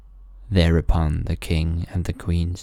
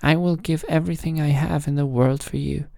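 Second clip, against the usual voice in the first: high, eager vow; fervent royal promise, pitched high; king crying eagerly; 9 semitones higher, faster than usual. A narrator's reading. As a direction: offer everything at once. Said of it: "Then cried the king".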